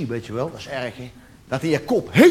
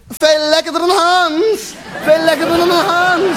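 higher, slow and louder, a hammed-up screamed boast; mock screaming girlfriend; 9 semitones higher, 3 dB louder than usual. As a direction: ham up her ecstatic scream. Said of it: "En ze schreeuwt".

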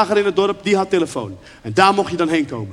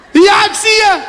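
put-on high, loud, laughing shout of a young girl; excited shout; 12 semitones higher, 8 dB louder, slower than usual. Young girl shouting into her phone when she spots her friend, excited laughing yell. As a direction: yell it out delighted, spotting your friend.

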